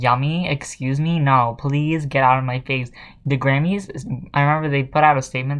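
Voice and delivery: ordinary talk, nothing marked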